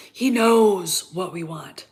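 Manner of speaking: ordinary talk, nothing marked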